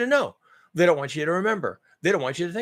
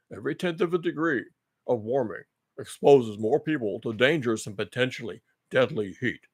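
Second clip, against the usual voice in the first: mock official reading; 6 semitones lower, 4 dB quieter than usual. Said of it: "rereading the expert's quote mockingly".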